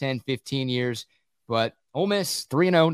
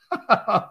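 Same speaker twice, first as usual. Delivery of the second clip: burst of laughter; 8 semitones higher, 3 dB louder than usual. Real, not acted. Laughs at listener text.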